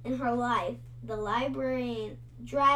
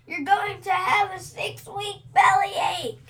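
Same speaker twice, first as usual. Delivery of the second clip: louder, higher plea; pleading cry; 8 semitones higher, 8 dB louder than usual. A narrator's reading. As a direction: cry out to make her stop. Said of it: "he cried".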